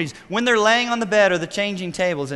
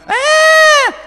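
piercing, sustained high screech; imitated child's scream; 14 dB louder than usual. Preacher mimicking daughter screaming as the van gets going, piercing scream. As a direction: let out a toddler's loud scream. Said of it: "She would scream".

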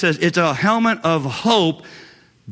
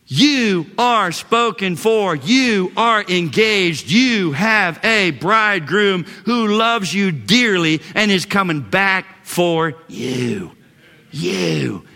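slow, weighted words, each one punched; measured, insistent preaching that settles on the last word; emphatic assurance; 2 semitones higher, slower than usual. Real, not acted. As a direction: drive it home word by word.

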